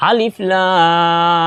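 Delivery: vowels drawn out very slowly in Quranic chant; drawn-out tajwid recitation of আলিফ লাম; elongated recitation. Shown as a demonstration. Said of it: "চার আলিফ টানতে হয়".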